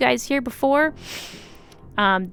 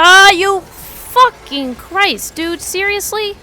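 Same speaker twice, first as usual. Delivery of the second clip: much louder, higher, drawn-out swearing; furious yell; 9 semitones higher, 13 dB louder, much slower than usual. Real, not acted. Gamer yelling at the game in furious disbelief. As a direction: blow up at the game after dying.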